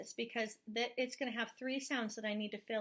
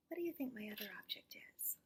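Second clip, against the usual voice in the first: faster unvoiced whisper; 3 semitones lower, 11 dB quieter, faster than usual. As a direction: whisper the question like a secret. Teacher quizzing child.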